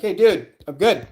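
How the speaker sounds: high, loud flustered refusal; declining politely. Retold or imitated. Streamer turning down the stranger, high flustered voice. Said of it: "I'm like".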